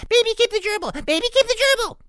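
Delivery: very high, loud squealed chant; piglet singsong. A narrator's reading. Baby pig claims Ruby as a pet, squealing singsong. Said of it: "Baby Sniff sang".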